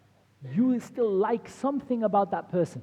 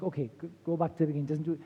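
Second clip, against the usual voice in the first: fast overwhelmed; 4 semitones lower, much faster than usual. Retold or imitated. Counselor overwhelmed by list.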